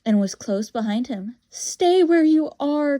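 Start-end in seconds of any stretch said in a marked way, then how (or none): 1.5-3.0 high, loud, slow warning cry. Acted. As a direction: call out a command to halt a pursuer. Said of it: "cried Diggory".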